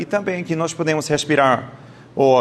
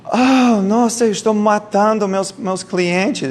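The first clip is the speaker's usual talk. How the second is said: imitating a panicked cry; 7 semitones higher, slower than usual. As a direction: act out a shocked masseuse realizing she's harming clients. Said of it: "uma mulher gritou".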